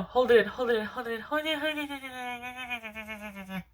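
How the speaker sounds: fading as the sneeze is held back; holding in a sneeze. Real, not acted. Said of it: "Oh, I have to sneeze".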